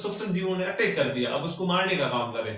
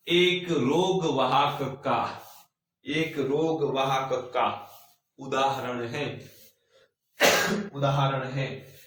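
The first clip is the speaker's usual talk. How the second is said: dictating; 2 semitones lower, much slower than usual. Shown as a demonstration. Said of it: "नीचे वाली line से लिखो".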